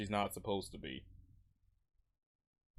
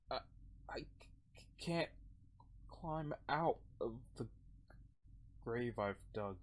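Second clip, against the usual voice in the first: despairing, stuck character; 10 dB quieter than usual. Acted. Voices despairing Odin in the story.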